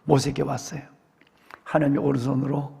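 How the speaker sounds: normal talking voice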